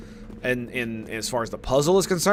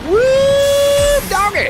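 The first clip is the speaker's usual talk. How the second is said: excited whoop; 9 dB louder, much slower than usual. Real, not acted. Celebrating in game.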